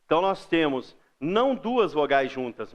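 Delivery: ordinary talk, nothing marked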